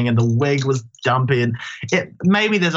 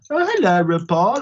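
stupid imitation voice; 4 semitones higher than usual. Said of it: "we'd do this stupid imitation voice".

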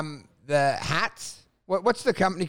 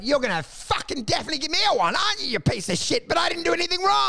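fast, higher indignant rant; mock outrage; 8 semitones higher, faster than usual. Accusing the driver of a one-star, indignant. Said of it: "So I'm like".

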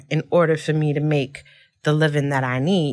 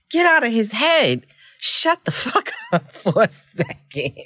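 laughing; 6 semitones higher than usual. Real, not acted. Mocking the man, laughing.

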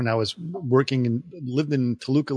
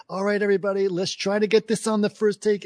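higher, evenly pitched actor impression; measured, high-pitched impression; Malkovich; 8 semitones higher than usual. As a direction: do a high-voiced actor addressing the crew. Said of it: "it was Malkovich saying".

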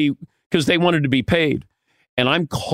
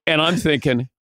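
normal talking voice

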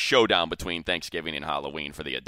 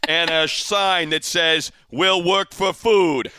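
high, slow, loud put-on manager voice; Joe Girardi voice; 10 semitones higher, 6 dB louder, slower than usual. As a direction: drag it out like a beaten coach. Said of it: "I'm Girardi".

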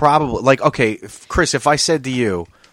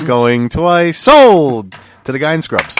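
loud, drawn-out closing call; auctioneer; 4 semitones higher, 8 dB louder, slower than usual. Pretend. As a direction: bang the gavel, sold!. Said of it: "It's like an auction in here".

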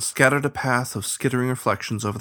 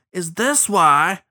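louder, higher angry call; 8 semitones higher, 6 dB louder, much slower than usual. A narrator's reading. Boater yelling from another boat, angry. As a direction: butt in angrily, drawing out the words. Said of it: "an angry voice came from another boat".

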